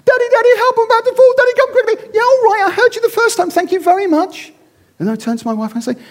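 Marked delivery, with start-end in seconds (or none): 0.0-2.1 put-on high, loud, panicked child's cry; child crying for help. Daughter clinging to a tree branch calls her dad, panicked high cry. 2.1-4.5 dismissive father. Acted. Father waving off his falling daughter's cry, hammed-up impatience.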